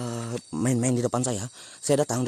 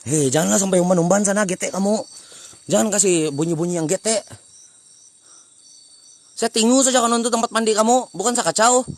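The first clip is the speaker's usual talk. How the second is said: loud, raised-pitch telling off; scolding shout; 5 semitones higher, 5 dB louder than usual. Real, not acted. Old man scolding unseen kids throwing things, loud scolding. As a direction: warn them off loudly like an annoyed elder.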